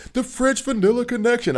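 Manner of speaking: high, loud hyped announcer call. Retold or imitated. Imitating the announcer who coined the nickname, high and loud. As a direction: belt it like a play-by-play man calling a big moment. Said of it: "he was like".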